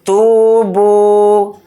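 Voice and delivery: تو and بو each held one alif length; slow even تو بو; both syllables stretched. Shown as a demonstration. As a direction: draw out تو and بو equally. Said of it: "دونوں کو برابر کھینچنا ہے".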